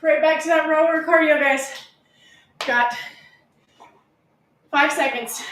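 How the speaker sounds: upbeat gym coach calling out; coach cheering. Acted. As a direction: pump up the class, counting down. Coach cheering through a workout.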